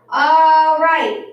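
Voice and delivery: loud, high "all right" over a rowdy class; calling to order. Acted. Principal to rowdy children, calling loudly for order. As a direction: get a wild room's attention.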